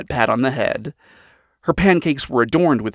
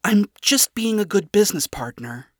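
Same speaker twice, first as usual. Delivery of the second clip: higher-pitched, mildly defensive excuse; apologetic; 8 semitones higher than usual. A narrator's reading. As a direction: shrug it off apologetically. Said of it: "shrugged apologetically".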